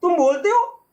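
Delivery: put-on girl amazed the letter box speaks; astonished girl. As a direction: stammer in disbelief. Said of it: "लड़की कह रही है".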